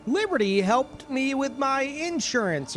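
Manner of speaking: high, slow, sugary commercial voice; mock the cheerful mom from the ad; ad lady. Streamer mocking the insurance ad mom, high and slow. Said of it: "And she's like".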